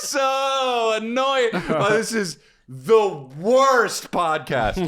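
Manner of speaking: high, drawn-out laughing wail; mock exasperation. Real, not acted. Host about own silly podcast, drawn-out mock exasperation. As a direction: draw every word out high and despairing.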